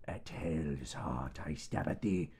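a hint of Captain Ahab intoning; lightly hammed-up classical recitation; mock-dramatic quote. Quoting Moby Dick while stabbing.

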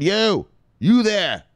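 high, slow, drawn-out royal summons; kingly call. Retold or imitated. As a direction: call out grandly to a soldier. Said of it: "And then he said".

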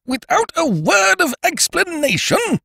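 high, indignant echo of the words; outraged repetition; echoing Ukridge. A narrator's reading. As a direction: throw the phrase back in a high voice. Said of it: "he echoed".

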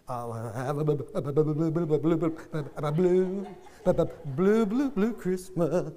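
slow stuttering Porky Pig singing. Retold or imitated. Preacher imitating his brother's Porky Pig song, stuttering. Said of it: "He does the Porky, Porky Pig style".

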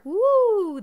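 high light head voice woo. Shown as a demonstration. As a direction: flip up into head voice. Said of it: "our head function".

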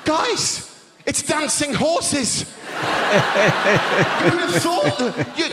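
high, excited and laughing; amazed. Real, not acted. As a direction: squeal with delight at it. Amazed about dancing horses.

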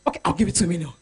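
a hint of the senator giving in; senator's offer. Senator raising his offer, mildly voiced.

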